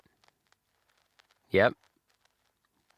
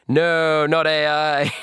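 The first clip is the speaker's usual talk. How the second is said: drawn-out, higher and louder fake protest; mock denial; 6 semitones higher, 4 dB louder, much slower than usual. Streamer jokingly denies the obvious, mock protest. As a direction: deny it in an exaggerated high voice.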